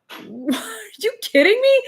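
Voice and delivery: shrieking high and loud in disbelief; you cannot believe what you just heard; incredulous outburst. Retold or imitated. Hearing the doctor allowed Monster in pregnancy, incredulous high shriek. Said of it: "And I was like".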